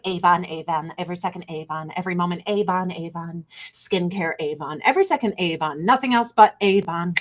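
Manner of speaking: low, monotone repetitive patter; mock sales drone. Streamer mocking demands to only talk skincare, droning sarcastically. Mocks being expected to only sell Avon.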